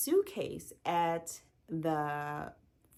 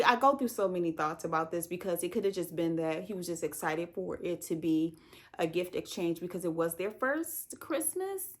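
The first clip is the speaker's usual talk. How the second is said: normal talking voice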